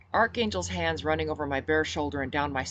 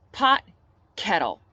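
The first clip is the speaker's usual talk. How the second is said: sharp comeback; 4 semitones higher, 8 dB louder than usual. Acted. Banter, throwing insult back.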